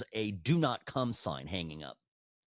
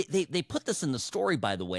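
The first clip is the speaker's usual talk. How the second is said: ordinary talk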